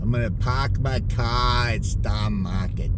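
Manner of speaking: put-on slow Boston accent, r's dropped. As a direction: stretch it out Boston style. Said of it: "practicing our Boston accent".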